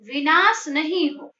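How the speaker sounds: ordinary delivery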